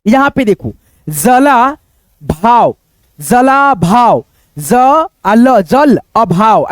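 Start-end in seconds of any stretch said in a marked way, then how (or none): 3.2-4.2 जला भाव said slowly, syllable by syllable; slow syllable demo. Shown as a demonstration. Teacher sounding out जला भाव for students, slowly. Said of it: "ऐसे करके आप पढ़ सकते हो".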